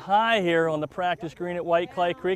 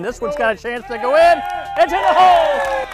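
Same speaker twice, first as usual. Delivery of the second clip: rising to a loud, high shout as it drops; excited shout; 8 semitones higher, 10 dB louder than usual. Real, not acted. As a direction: call the putt going in, building to a yell. Ball drops in, excited call.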